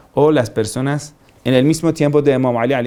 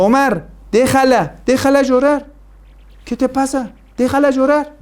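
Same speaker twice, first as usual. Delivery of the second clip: prophet's rebuke; 9 semitones higher than usual. Retold or imitated. Prophet intervenes, defending the mourner.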